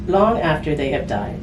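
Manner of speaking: normal talking voice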